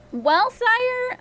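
high, reluctant servant's sigh; acted weary hesitation, high-pitched. A narrator's reading. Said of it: "a servant said with a sigh".

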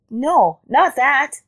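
high rabbit protest; crying out. A narrator's reading. Rabbit correcting Leopard's guess, high cry. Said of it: "cried the rabbit".

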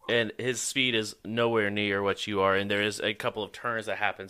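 ordinary delivery

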